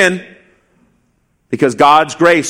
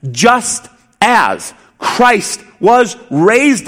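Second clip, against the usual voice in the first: Just as Christ was raised drawn out very slowly and higher; slow proclamation; 5 semitones higher, much slower than usual. Real, not acted. Preacher to congregation, proclaiming slowly and higher.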